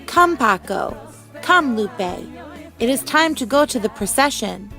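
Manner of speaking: plain reading voice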